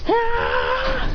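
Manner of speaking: high, drawn-out "ah" cry; high yelp held long, no extra volume; acted cry. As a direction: cry out high as the fight starts. Character cries out in a fight.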